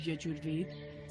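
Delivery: usual speaking voice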